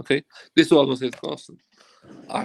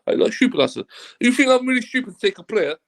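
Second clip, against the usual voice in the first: pitched up, indignant mock rant; retold outrage; 7 semitones higher than usual. Agent retells snapping back at the club boss, raised indignant voice. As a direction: throw the accusation back at him, higher.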